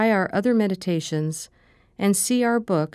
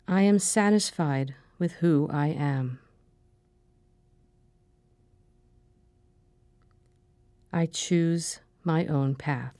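calm, measured meditation voice, short phrases with long pauses; guided meditation. Acted. Meditation leader giving affirmations to repeat after her, calm and measured. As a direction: guide them gently through breathing and affirmations, unhurried.

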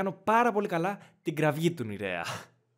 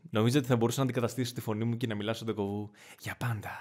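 ordinary delivery

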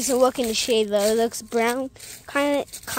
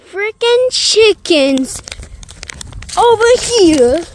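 high, loud and slow; excited shout; 6 semitones higher, 9 dB louder, slower than usual. Real, not acted. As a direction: call it out, thrilled. Shouting about the chickens.

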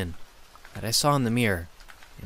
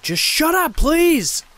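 much higher and louder, a furious shriek; infuriated yell; 9 dB louder, slower than usual. Acted. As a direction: shriek at him to stop. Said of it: "I yelled, infuriated".

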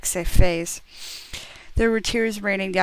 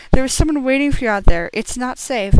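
urgent warning; 5 semitones higher, 7 dB louder, faster than usual. Acted.

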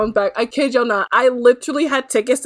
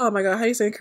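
ordinary talk

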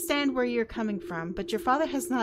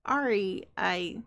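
usual speaking voice

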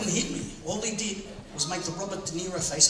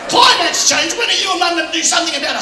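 high, loud, insistent demand; exasperated son; 11 semitones higher, 10 dB louder than usual. Retold or imitated. Son pressing his dad for action, exasperated. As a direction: push it out like a fed-up son.

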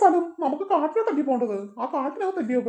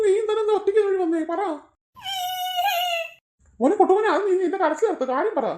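much higher, fussing auntie voice; a higher-pitched, fussing neighbour woman; fussing village woman; 9 semitones higher than usual. Acted. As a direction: coax the crying boy as a worried neighbour.

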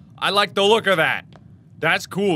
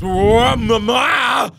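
loud high whoa at the monster; startled shout; 7 semitones higher, 7 dB louder, much slower than usual. Real, not acted. Gamer startled by the monster mother, shouting. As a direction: yelp it out as she appears.

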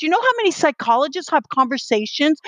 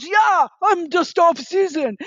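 mock happy shout; 5 semitones higher, slower than usual. Guy yells back his new label, hammed-up slow shout. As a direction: belt it out like a converted fan. Guy happily repeats her reframe.

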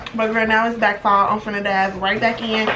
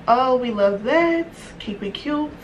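ordinary talk

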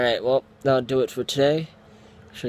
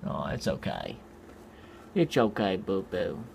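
low, soft comfort; quiet reassurance for a pet that won't be held; 5 semitones lower, 5 dB quieter than usual. Real, not acted. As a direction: soothe the pet gently.

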